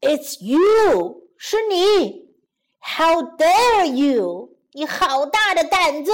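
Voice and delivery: loud, indignant giant roar; shouting. Acted. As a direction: shout it out with slow, weighty outrage. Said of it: "大叫起来". (巨人愤怒地大叫)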